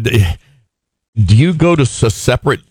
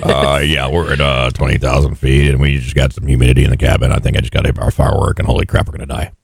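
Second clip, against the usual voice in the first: low, fast, deadpan cockpit call; deep calm captain reporting disaster; pilot voice; 8 semitones lower than usual. Pretend. Mocking pilot announcement again.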